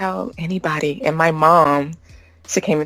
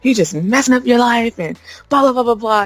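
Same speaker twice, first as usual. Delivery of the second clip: imitating dad's rant; 6 semitones higher, 4 dB louder than usual. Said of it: "his rant".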